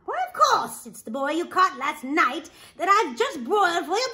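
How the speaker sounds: loud, high, fast mock scolding with a laugh; scolding wife. Wife teases the ogre for his mistake, laughing scold. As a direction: tease the giant for his forgetfulness.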